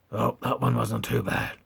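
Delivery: ordinary talk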